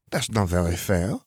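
lightly voiced protest; mild protest. A narrator's reading. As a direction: object a little, as a character in a story. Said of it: "Gabby protested".

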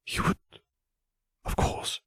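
hushed, unvoiced whisper; soft intimate whisper, barely there. A narrator's reading. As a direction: whisper it tenderly. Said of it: "he whispered".

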